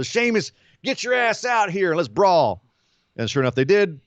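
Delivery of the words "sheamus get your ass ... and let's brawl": raised-pitch mock call-out; retold challenge. Host voices Jeff Hardy calling Sheamus out, challenging. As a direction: call out a rival to a fight, lightly acted. Acts out wrestler calling out rival.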